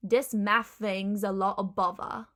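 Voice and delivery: Cockney "Dis maff fing's a lo' of bovva"; whole phrase in Cockney, F and V for TH; Cockney accent. Shown as a demonstration. Teacher putting the phrase together, Cockney accent. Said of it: "that V in the middle".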